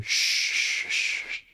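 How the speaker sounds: a string of fading shushes; shushing. Acted. Game master voices someone hushing Alia, shushing. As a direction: hush someone repeatedly, trailing away. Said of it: "And then you hear".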